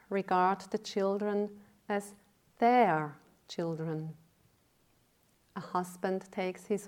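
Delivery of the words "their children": "their" slow and emphatic, then falling; stress on "their". Real, not acted. Teacher on parents regarding children, stressing "their".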